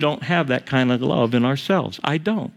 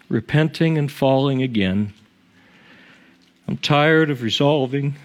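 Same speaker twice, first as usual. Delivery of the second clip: hymn recitation; 3 semitones lower than usual. Acted.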